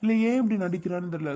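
ordinary talk, nothing marked

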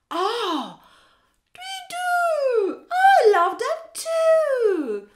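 high owl gushing about berries. Acted. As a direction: hoot it out sweetly like an owl. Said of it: "says Owl".

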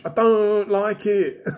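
slow, laughing it off. Real, not acted. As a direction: chuckle through the complaint. Laughing at own disappointing paint.

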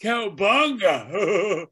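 slow, high, drawn-out surfer cheer; hammed-up cartoon catchphrase; surfer turtle cry. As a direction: stretch it out like a stoked surfer dude.

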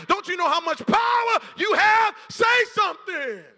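loud preaching shout as God. Retold or imitated. Pastor voicing God urging believers, shouting. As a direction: shout God's call to the church. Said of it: "God's like".

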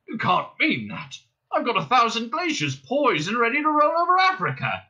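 high, loud pleading protest; indignant old man. A narrator's reading. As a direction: protest in disbelief.

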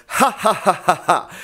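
bouncy staccato "Ha" from the belly; diaphragm laugh exercise. Shown as a demonstration. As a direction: pulse each "Ha" off the diaphragm. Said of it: "laugh really hard for me".

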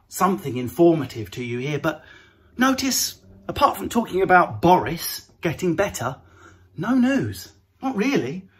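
ordinary delivery

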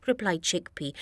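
usual speaking voice

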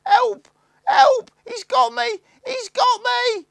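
very high, loud screaming for help; boy trapped, screaming in terror; shrill scream of terror. A narrator's reading. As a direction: shriek it, caught. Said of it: "a shrill scream of terror".

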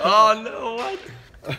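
high, loud shocked gasp; shocked and caught out; surprised. Real, not acted. Caught switching friend's photo.

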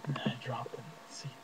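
soft whispered aside. Acted. Sinking back into his chair, whispered. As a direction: drop to a bare whisper. Dreamy hush after the speech.

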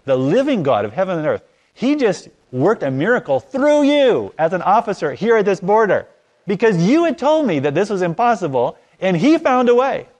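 rising loud, high proclamation; fervent. Retold or imitated. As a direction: build to a preacher's climax. Passionate testimony to the officer.

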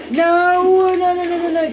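high, loud, stretched cry of protest; panicked yell. Real, not acted. Gamer about to lose, panicked yell. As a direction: yell it long, then tumble into repeats.

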